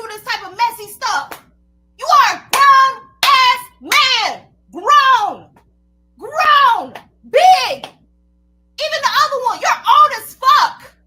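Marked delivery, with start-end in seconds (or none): 2.0-10.9 furious shout. Real, not acted. Yelling at old man.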